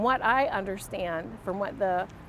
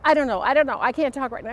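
louder, hurried retelling of a panicked brush-off; abrupt flustered outburst; 4 semitones higher, 6 dB louder, much faster than usual. Retold or imitated. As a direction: blurt it out like you're cornered and want off the phone. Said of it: "she answered very abrupt".

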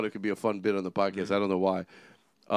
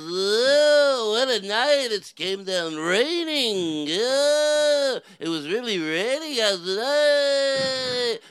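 high, loud wail with endless vowels; 11 semitones higher, 6 dB louder, much slower than usual. Acted. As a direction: play up a slow singer's howl. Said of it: "this is [name]".

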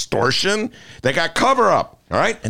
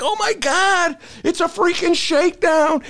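much higher, shocked outcry at the shakedown; stunned disbelief. Retold or imitated. Retelling the Trump call to the co-host, shocked squeal. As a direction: squeal it like you can't believe it. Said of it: "And I'm like".

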